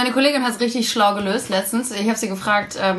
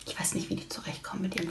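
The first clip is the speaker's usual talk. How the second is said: breathy whisper; 3 semitones lower, 14 dB quieter, faster than usual. Real, not acted. (geflüstert)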